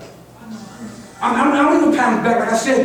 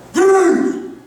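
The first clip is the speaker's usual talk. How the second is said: calling out high and loud to get noticed; screamed name; 9 semitones higher, 3 dB louder than usual. Retold or imitated. Retold calling out to Bruce at Wildcat, loud high yell. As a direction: yell the name across the room. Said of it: "I screamed out".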